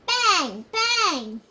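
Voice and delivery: put-on a child's loud gun noises; gunshot sounds. A narrator's reading. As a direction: pop out each bang like a gunshot. Farmer fires his gun.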